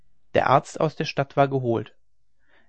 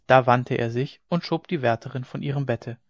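usual speaking voice

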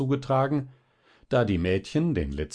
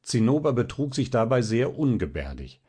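ordinary delivery

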